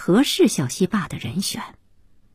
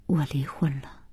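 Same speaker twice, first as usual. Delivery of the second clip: hushed and hesitant; quiet admission; 2 semitones lower, 8 dB quieter than usual. Acted. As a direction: admit it reluctantly, under the breath. Awkward admission of divorce.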